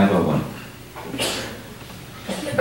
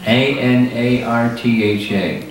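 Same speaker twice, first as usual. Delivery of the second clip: slow, letter by letter; each letter separate and measured; spelling out. Shown as a demonstration. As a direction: spell it out evenly for someone writing it down. Spelling out a term for listener.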